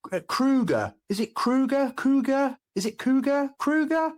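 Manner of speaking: high, rising, confused guesses; confused guessing. Retold or imitated. Tourist guessing the store's name to a local, confused. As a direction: fumble for the shop's name. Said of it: "And I kept saying".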